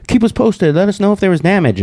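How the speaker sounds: high-pitched mock reporter voice; imitating news people. News people asking about damage, high imitated voice. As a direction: put on a high voice for the newsroom. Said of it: "they're like".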